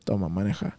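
low, soft, sluggish imitation; low-key request. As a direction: mumble it low to a brother, as if wiped out. Retells his high, sluggish request.

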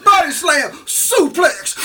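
high, loud mock wrestler yell; wrestling cry. Imitating a big cousin yelling body-slam moves. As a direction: yell it like a cousin mid-suplex. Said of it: "of him going".

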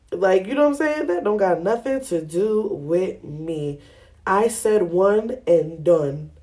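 slow, firm laying down the rule; slow emphatic. Real, not acted. Explaining the one-and-done plan, slow and firm. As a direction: drag each word out to make the point.

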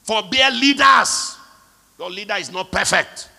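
full-force preaching. Real, not acted. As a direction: drive it home to the church.

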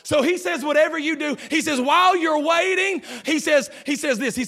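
high, loud preacher's shout building through the line; full-force preaching. Acted. Pastor shouting to the congregation, full-force preaching. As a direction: preach it out to the whole church.

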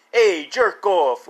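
drawn-out, cocky tough-guy imitation; Dice. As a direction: stretch it slowly like a mock wise guy. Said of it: "he could sound like Dice".

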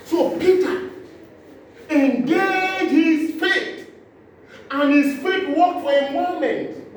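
loud, high preacher's cry; fervent pulpit preaching; preaching at full force. Acted. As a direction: build the sermon to a shout.

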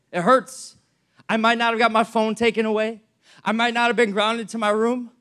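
ordinary talk, nothing marked

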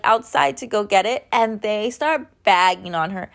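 normal talking voice

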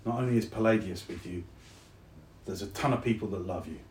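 low, hushed and sincere; tender reassurance. Acted. Soldier tells lonely friend they are loved, tender. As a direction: soften steadily into a quiet, loving admission.

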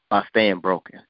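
normal talking voice

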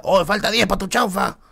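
the cook yelling an order update to his customer; shouted call from the cook. Retold or imitated. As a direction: shout it across the shop like the cook. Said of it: "Y el chino grita".

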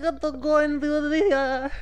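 high-pitched put-on celebrity voice; a hammy high Jared Leto; Jared Leto impression. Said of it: "give us your best, uh, Jared Leto".

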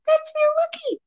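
very high, squeaky sarcastic reply; thin high-pitched, rattled retort; dazed retort. A narrator's reading. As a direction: squeak it out while still disoriented. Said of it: "you mumble, still desperately trying".

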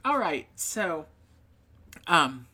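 ordinary talk, nothing marked

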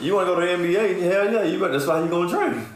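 high, loud pushy coach; mock hype coach. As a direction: pump the kid up. Voicing coach to kid.

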